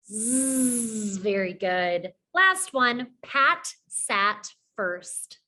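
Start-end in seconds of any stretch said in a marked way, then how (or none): 3.2-5.4 put-on slow model sentence for children; slow, clear sentence. Shown as a demonstration. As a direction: say each word slowly and clearly. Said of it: "And the last sound?".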